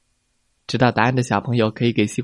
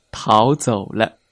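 usual speaking voice

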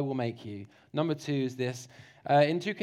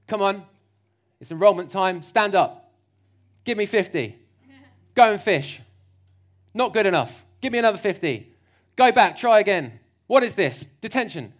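barking loud army drill-sergeant orders, higher; 4 semitones higher, 9 dB louder than usual. Pretend. Preacher mocking a harsh Jesus as a shouting drill sergeant. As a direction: yell at recruits like a harsh general. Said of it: "He doesn't say that".

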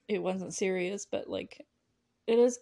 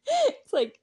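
ordinary delivery

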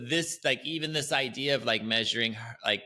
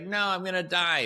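mock despair; 8 semitones higher than usual. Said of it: "And it's like".

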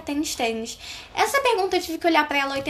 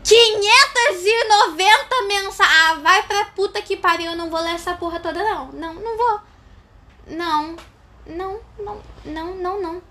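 loud fed-up outburst; exasperated shout; 5 semitones higher, 7 dB louder than usual. Real, not acted. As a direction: blow up at the screen. Exasperated at a long message.

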